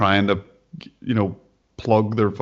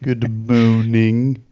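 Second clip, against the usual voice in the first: slow, drawn-out mock French accent; greeting Keith in a hammed-up French accent; bad French accent; 4 dB louder, much slower than usual. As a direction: stretch the vowels like a fake Frenchman. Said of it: "a British policeman who had a really bad French accent".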